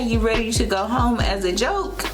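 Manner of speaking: usual speaking voice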